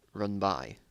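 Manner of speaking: ordinary delivery, nothing marked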